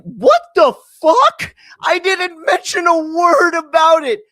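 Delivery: high, loud, drawn-out disbelief; outraged mock yell. Telling his shocked reaction to the landlord's accusation, hammed-up shouting.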